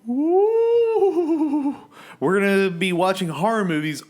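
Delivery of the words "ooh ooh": high, loud ghostly ooh. Pretend. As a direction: wail like a cartoon ghost. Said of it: "give me your best ghost impression".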